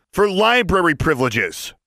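high, loud ringing demand; loud, carrying voice. A narrator's reading. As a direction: call it out boldly. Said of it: "said in a loud, carrying voice".

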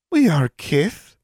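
high, slow little elf voice; tiny elf. Acted. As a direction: speak like a small wrinkled creature. Small creature speaks.

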